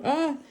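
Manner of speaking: sudden high startled cry; startled yelp. Real, not acted. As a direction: yelp as it falls. Something dropped to the floor.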